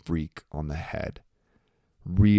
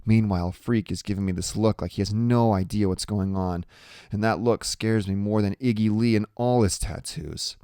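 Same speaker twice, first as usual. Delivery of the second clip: ordinary delivery, nothing marked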